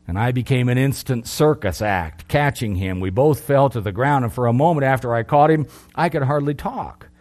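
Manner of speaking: usual speaking voice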